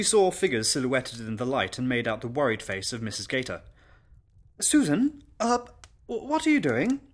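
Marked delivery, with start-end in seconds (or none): 4.6-7.0 high, loud, stammering lady of the house; flustered employer. Acted. Worried mistress finds governess with poker, flustered stammer. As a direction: falter upward in startled worry.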